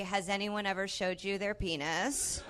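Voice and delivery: normal talking voice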